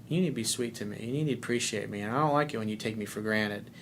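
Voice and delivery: quiet firm correction; low steady voice, no anger; a firm, hushed warning to his girlfriend; firm, low and soft. Shown as a demonstration. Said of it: "that little look and that firmness".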